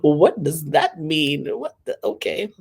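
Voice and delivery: slow, puzzled question; disbelieving what did I just read; baffled. Real, not acted. Vlogger reacting to a strange post, baffled.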